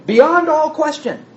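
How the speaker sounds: BEYOND ALL QUESTION pitched high and drawn out; emphatic. Acted. Teacher voices a translator's reading of the author, emphatic. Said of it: "he's being emphatic".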